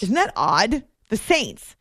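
ordinary talk

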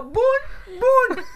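loud high bum bum imitating vomit; vomit sound effect. Retelling childbirth, imitating vomit loudly. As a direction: burst it out like a splash. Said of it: "hizo el vómito".

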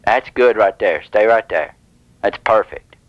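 low, settled reassurance; soothing approval. Pretend. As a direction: drop the pitch and coax them to hold it. Approves while telemarketer is silent.

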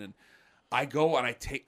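ordinary talk, nothing marked

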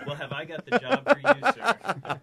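loud, high hard-sell recruiter voice; recruiter pitch. Pretend. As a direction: overeager salesman offering the deal. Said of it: "Spoken like a true recruiter".

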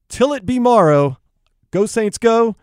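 rally the listeners behind the Saints; rallying sign-off. Real, not acted. Host signing off to listeners, a loud rallying cheer.

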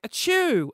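high, loud pretend sneeze; fake sneeze. Teenage boy hiding his crying from parents, fake sneeze. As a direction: sneeze theatrically to cover tears.

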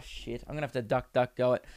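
a quick hushed curse while ducking; sudden hush. Real, not acted. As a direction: duck out of sight and go quiet. Hiding quickly mid-recording.

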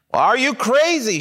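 shocked. Acted. Girl refusing a kiss.

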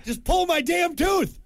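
high, slow exasperated plea; exasperated patient. Retold or imitated. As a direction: plead to just get it over with. Impatient patient.

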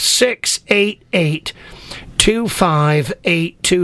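slow each digit out clearly; slow, spelled-out phone number. Shown as a demonstration. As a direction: read the number slowly for callers. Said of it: "phone number repeated slowly".

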